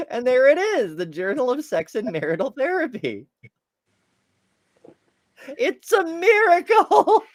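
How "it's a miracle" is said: high, slow, laughing through words. Real, not acted. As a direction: giggle out the words. Laughing at found journal.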